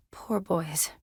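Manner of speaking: soft, whispered sorrow; hushed pity. Acted. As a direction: let it fall to a whisper, mourning them. Mourning the named boys.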